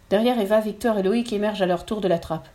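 ordinary delivery, nothing marked

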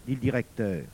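ordinary delivery

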